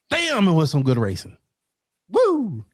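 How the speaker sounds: excited outburst capped by a high whoop; thrilled fan cheer, pitch leaping high; real excitement. Real, not acted. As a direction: let it burst out, then whoop. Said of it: "Sorry, I'm excited".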